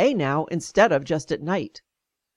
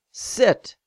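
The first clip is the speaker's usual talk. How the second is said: curt one-word command; retold doctor's order; 3 semitones higher than usual. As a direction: order them to sit, briskly. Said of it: "he ordered".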